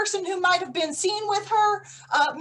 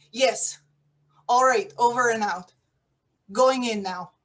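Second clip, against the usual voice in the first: ordinary talk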